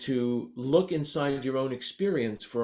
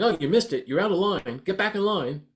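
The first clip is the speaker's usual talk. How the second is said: higher, louder, scolding school principal; 8 semitones higher, 7 dB louder than usual. Retold or imitated. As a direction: bark it like a strict vice principal telling off a kid. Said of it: "like a junior high school principal going".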